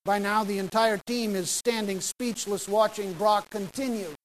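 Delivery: usual speaking voice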